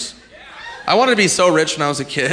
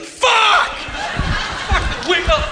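furious yell; much slower than usual. Retold or imitated. Losing contestant screaming at the show in hammed-up fury. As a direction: explode like a contestant who just went bankrupt. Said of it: "I'd be like".

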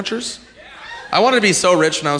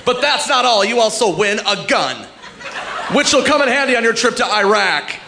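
game show host; 8 semitones higher than usual. Retold or imitated. Host proudly presenting a wolf as the prize, in an overblown announcer voice. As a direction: sell the wolf like a luxury car. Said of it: "If they're like".

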